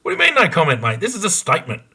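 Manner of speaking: put-on detective losing patience with a boastful kid; exasperated disbelief. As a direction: throw his no comment back at him. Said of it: "And we're like".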